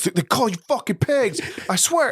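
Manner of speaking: very high mock-frantic squeal; crazed outburst. Craig gets crazy accusing Austin to cops, crazed outburst. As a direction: imitate a desperate man snitching at the top of his pitch. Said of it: "Craig gets crazy, and he goes".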